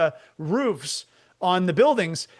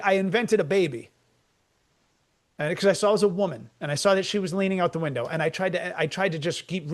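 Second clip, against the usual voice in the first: ordinary talk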